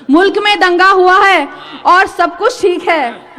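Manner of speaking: ringing, rhythmic Urdu recitation; poetry recital. Acted. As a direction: project it as mushaira verse. Recites the same line as verse.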